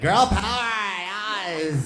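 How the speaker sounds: high, loud squeal of enthusiasm; mock pop-fan cheer. Teen in front of a Spice Girl poster, gleeful cheer. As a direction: cheer it like a superfan.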